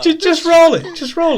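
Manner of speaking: high, loud insistence; shouting. Real, not acted. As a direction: shout over the table. GM insists over the noise.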